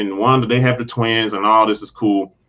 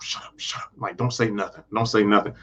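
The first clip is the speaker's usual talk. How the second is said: urgent hush, barely voiced at first; hushed whisper; 3 semitones lower, 7 dB quieter than usual. Agnes keeps telling Herb not to talk, hushed whisper. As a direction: imitate a secretive whispered shushing. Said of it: "hush whispering with each other".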